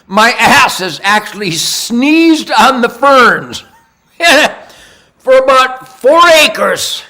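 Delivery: slow, loud, laughing through the words; cracking himself up. Real, not acted. Cracking himself up mid-story.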